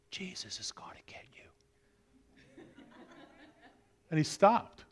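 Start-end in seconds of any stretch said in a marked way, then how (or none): 0.1-2.6 slow eerie whisper in the dark; spooky whisper. Acted. Robber hears a voice in the dark house, whispered. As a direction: breathe it like a hidden ghost. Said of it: "he heard this voice".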